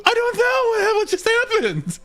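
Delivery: very high, near-crying confusion; real high-pitched panic on the edge of laughter; helpless bewilderment. Real, not acted. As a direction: plead total ignorance, voice way up. Asked what they did, baffled.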